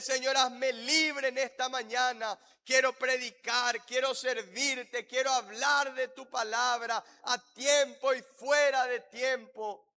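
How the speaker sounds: emphatic, pleading prayer phrases; fervent led prayer. Real, not acted. Pastor leading the church in asking to serve, fervent prayer. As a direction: build a insistent plea to God.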